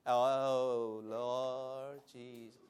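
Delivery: low, slow, half-hearted calling; mock a lifeless routine prayer; soft, slow, unenthusiastic mumble; mock dull call. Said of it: "It's not, it's like".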